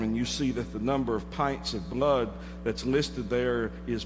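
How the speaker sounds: preacher hushing the congregation at the grim turn; hushed sermon storytelling. Acted. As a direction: draw them in close with a near hush. Hushed climax of the illustration.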